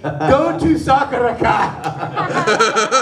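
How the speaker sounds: drawn-out theatrical yell; hammed-up chef shout. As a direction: proclaim it in a long mock bellow. Chef's shouted line, subtitled.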